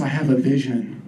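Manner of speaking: low, halting pronouncement with pauses; low-pitched mystic, phrase by phrase; psychic medium voice. Retold or imitated. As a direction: deliver a vision like a medium. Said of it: "She has this very medium voice".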